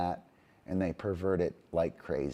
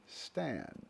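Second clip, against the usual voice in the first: quick soft stand; 4 semitones higher, 12 dB quieter than usual. Shown as a demonstration. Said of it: "the Sesame Street thing".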